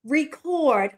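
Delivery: record as REE-KORD, long ee; open syllable blended. Shown as a demonstration. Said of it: "that would be an open syllable".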